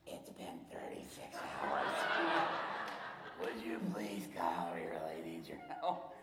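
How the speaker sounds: slow, low, breathless whisper of an exhausted man. Retold or imitated. Pastor on voicemail whispers slowly, begging for help after a long night.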